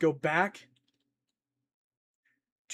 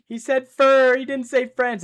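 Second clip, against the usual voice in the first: much higher and louder protest; indignant outburst; 9 semitones higher, 11 dB louder than usual. Real, not acted. Streamer protesting to chat that he said fur, loud and high. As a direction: burst out in amused disbelief. Streamer reacts to misheard word.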